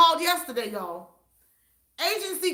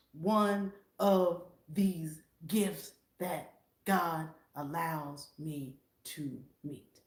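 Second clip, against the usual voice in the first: hushed whisper; 8 semitones lower, 7 dB quieter, much slower than usual. Real, not acted. Sharing her faith in a soft whisper. Trailing whisper about God's gifts.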